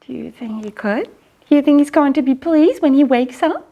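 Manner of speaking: ordinary delivery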